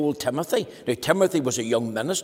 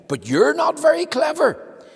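much higher, louder taunt; sing-song it as a tease; mocking chorus; 14 semitones higher, 6 dB louder than usual. Happy Land folk mocking him.

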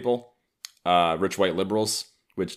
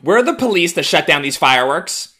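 higher, louder mocking voice; 9 semitones higher, 9 dB louder than usual. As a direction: sneer it like a self-righteous liberal. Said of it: "are now being like".

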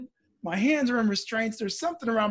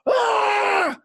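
loud, high sustained fighting yell; re-enacted scream; 14 semitones higher, 5 dB louder than usual. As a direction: scream out as if fending off attackers. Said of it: "scream and throw a punch".

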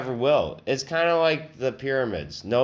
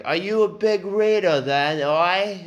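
high mock British needling; British accent; 8 semitones higher, 4 dB louder than usual. Salesman quizzing his customer on hobbies, in mock British accent. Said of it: "anybody with that accent".

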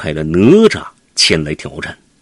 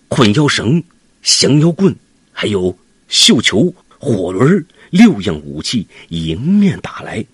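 ordinary delivery

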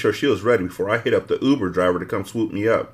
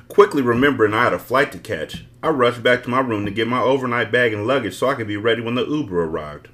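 plain reading voice

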